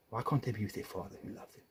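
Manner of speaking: ordinary talk, nothing marked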